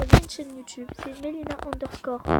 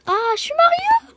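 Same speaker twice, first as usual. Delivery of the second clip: high, loud squeaky Mario cry; voix de Mario; 14 dB louder than usual. Pretend. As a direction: shout it like the plumber hero himself. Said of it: "j'adore ce, euh, personnage-là". (voix aiguë et forte de Mario)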